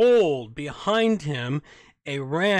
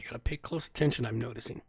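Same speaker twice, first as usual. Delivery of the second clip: normal talking voice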